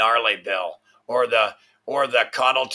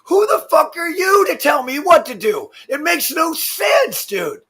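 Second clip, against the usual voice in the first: much higher, louder furious yelling; furious shout; 6 dB louder than usual. Real, not acted. Ranting streamer yells at politicians making rules, furious shout. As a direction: blow up at whoever tells you what to do.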